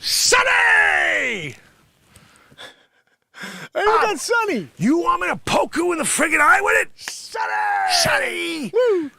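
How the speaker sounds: high, loud yells; comic shout. Acted. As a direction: yell his name. Shouting retake.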